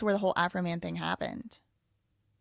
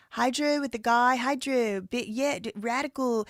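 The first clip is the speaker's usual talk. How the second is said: Southern vowel fronting; 4 semitones higher than usual. Shown as a demonstration. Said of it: "It's called vowel fronting".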